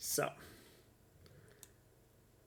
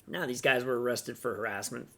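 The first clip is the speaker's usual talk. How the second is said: normal talking voice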